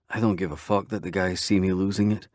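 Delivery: ordinary delivery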